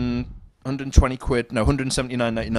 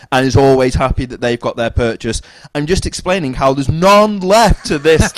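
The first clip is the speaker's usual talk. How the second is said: loud, higher mock customer-service voice; fake polite; 5 semitones higher, 9 dB louder than usual.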